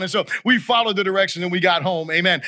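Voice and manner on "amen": ordinary talk, nothing marked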